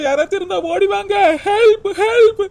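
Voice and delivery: high, sobbing cries for help; desperate, high-pitched call; shouting for help. Acted. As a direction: scream tearfully for someone to come. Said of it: "ஹெல்ப்னு கத்திக்கிட்டு கிடக்கேன்".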